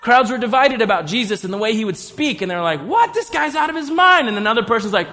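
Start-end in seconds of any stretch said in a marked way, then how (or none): 2.8-4.3 high-pitched, scoffing disbelief; incredulous. Acted. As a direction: play a baffled heckler in the crowd. Scornful crowd reacting to Jesus.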